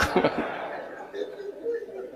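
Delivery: very high breathy laugh, soft; a thin high giggle. Real, not acted. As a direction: giggle helplessly. Preacher laughing at own joke.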